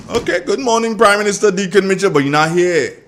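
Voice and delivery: high, loud, mock-grand hello; big on-air salute; teasing loud greeting. Real, not acted. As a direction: hail the prime minister with playful pomp. Host teasingly greets absent minister.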